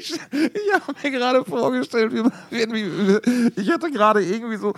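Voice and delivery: talking through high giggles; laughing. Real, not acted. Explaining why he cracked up, laughing through words. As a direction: squeeze words out between laughs.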